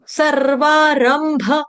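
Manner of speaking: सर्वारंभ slowly, syllable by syllable; slow, broken up. Shown as a demonstration. Teacher breaking up a hard word. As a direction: split सर्वारंभ into parts. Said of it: "तोड़ तोड़ के पढ़ लेगा".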